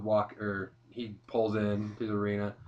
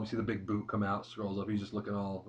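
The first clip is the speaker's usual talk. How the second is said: ordinary talk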